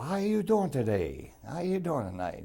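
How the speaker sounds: friendly greeting. Retold or imitated. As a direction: sing it out like a friendly stranger. Friendly Dutchman greeting the narrator.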